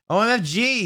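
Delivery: high pitched outburst; yelled. A narrator's reading. James yelling at the botched song, high. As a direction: yell it in disbelief. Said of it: "yielded James".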